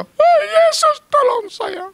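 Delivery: very high-pitched plea for help; thin, high mock wail; imitated desperate cry. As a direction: mimic a frightened cry to Jesus. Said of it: "dia mangap pintu. Dia langsung".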